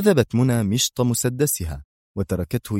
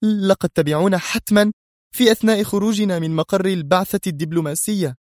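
high-pitched, tense annoyance; tense indignation; 10 semitones higher than usual. A narrator's reading. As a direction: blame with nervous indignation. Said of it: "قالت منى في سخط متوتر".

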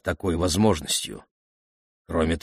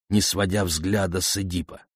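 ordinary delivery, nothing marked

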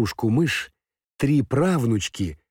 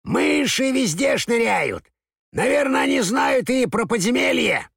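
excited crow voice; 14 semitones higher than usual. Acted. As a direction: squawk it out as an alarmed crow. Excited crow's alarmed cry.

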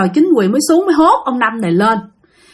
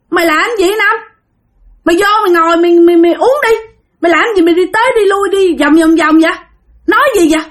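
calling out; 6 semitones higher, faster than usual. Retold or imitated. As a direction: yell across to the wandering friend. Drinking buddies call out to Năm.

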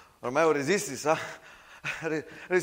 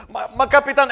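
loud, higher stammered protest; stammering shout; 8 semitones higher, 11 dB louder, faster than usual. Acted. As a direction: cry out in protest, tripping on the words. Soldier protests again.

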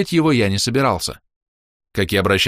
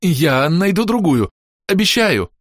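high, loud, slow groveling promise; terrified fawning; 9 semitones higher, 5 dB louder, slower than usual. A narrator's reading. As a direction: grovel in horror. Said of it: "залебезил Шёпот с ужасом".

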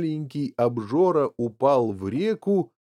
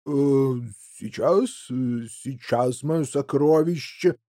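hesitant, halting father's voice; flustered placating father; slower than usual. A narrator's reading. Father stalling his demanding daughter, flustered and placating.

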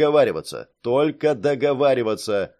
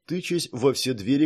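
plain reading voice

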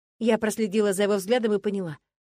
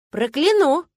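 mock threat; 7 semitones higher, 9 dB louder, slower than usual. A narrator's reading. Playful threat in a quarrel.

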